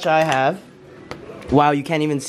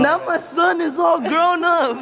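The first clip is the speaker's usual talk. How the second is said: proud parent voice; 10 semitones higher than usual. Pretend. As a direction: cheer him on like a proud dad. Mock proud parent again.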